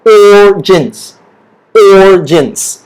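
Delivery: slow "Origins" with a long rounded "or"; round OR sound. Shown as a demonstration. Teacher coaches mouth shape, slow rounded "Origins". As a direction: round the lips on "Or", then "jins". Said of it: "make sure your mouth is round".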